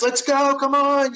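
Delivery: high, loud playful rally; mock rally shout. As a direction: pump up the team in jest. Imitating motivating leader.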